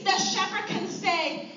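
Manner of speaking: normal talking voice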